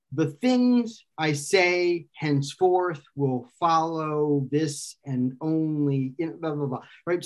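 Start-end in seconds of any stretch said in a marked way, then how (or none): 0.1-6.1 slow, measured beat alternating unstressed and stressed syllables; metrical verse. Shown as a demonstration. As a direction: chant "the things I say henceforth" as iambic verse. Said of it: "follows a certain kind of pattern".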